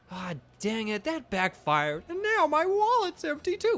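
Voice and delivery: high, far-from-natural character voice; whiny game character. Acted. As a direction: squeak out a woeful complaint.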